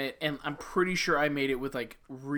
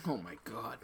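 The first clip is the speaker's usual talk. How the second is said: breathless whisper of disbelief; 6 semitones lower, 13 dB quieter than usual. Real, not acted. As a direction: whisper it, stunned. Reacts to eggnog dipping.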